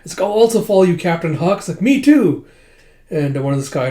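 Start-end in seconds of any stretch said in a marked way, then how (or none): 0.2-1.6 high, raised acted voice; eager pledge. Soldier vowing to follow the captain, eager high voice. 1.8-2.5 very high, loud acted chime-in; eager agreement. Second soldier joining the pledge, eager squeal.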